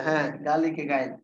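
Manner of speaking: ordinary talk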